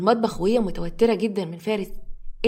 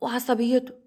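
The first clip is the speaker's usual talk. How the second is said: ordinary delivery, nothing marked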